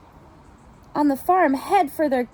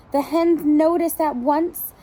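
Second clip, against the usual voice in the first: plain reading voice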